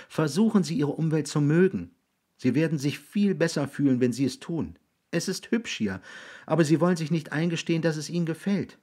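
put-on high, persuasive young voice; coaxing boy. Jiggs coaxing William to like his surroundings, earnest.

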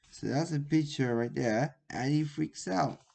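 ordinary delivery, nothing marked

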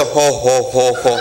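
a lightly voiced Santa chuckle, dropping low; hint of a jolly Santa's jo, jo, jo. Comedian imitating the hired Santa laughing, lightly voiced. Santa at rich friend's house.

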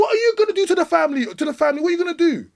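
high, fast and loud; fast, high, insistent demand; heated challenge. Real, not acted. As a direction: rattle it off, pressing hard. Heated argument, rapid challenge.

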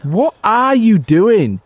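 loud, slow, drawn-out demand; exasperated. Real, not acted. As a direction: stretch each word in exasperation.

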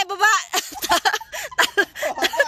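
ordinary talk

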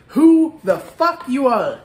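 slow, punched out warning; emphatic. Real, not acted. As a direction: hammer each word home. Stern warning to viewers.

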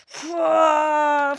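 loud attack shout; battle yell. Acted. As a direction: throw a punch with a yell. (saldırı çığlığı)

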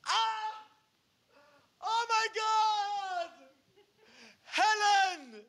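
high, loud wailing cry; very high loud slow anguished cry; anguished shout. Retold or imitated. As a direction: howl like a betrayed partner. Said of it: "he's like".

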